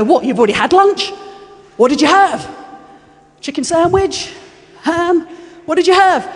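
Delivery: baffled, hammed-up questions climbing high; confused questioning. Disciples quiz Jesus about his lunch, comically confused. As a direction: act out puzzled disciples, pitch rising as they guess. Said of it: "with even more confusion".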